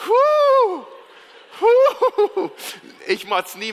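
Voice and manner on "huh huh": loud, high comic whoop; high hooting "huh". Acted. Complainer after his embarrassing mistake, whooping loudly. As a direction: let out two drawn high hoots.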